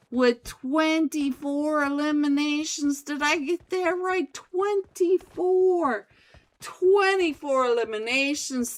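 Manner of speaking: loud hyped caster call; excited play-by-play. Acted. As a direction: shout the highlight like a caster. Live esports play-by-play.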